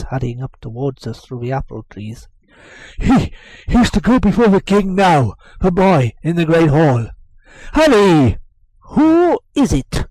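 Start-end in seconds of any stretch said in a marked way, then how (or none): 3.0-8.4 loud urgent summons; urgent servant. Acted. 8.9-10.1 nurse asking. Acted.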